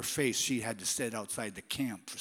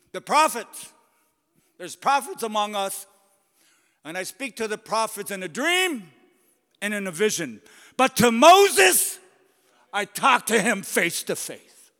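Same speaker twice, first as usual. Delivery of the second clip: loud, high preaching as God, slowing on face to face; booming God defending Moses; God's thundering rebuke; 11 semitones higher, 15 dB louder than usual. Acted. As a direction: rebuke them with full divine force. That's how I would speak.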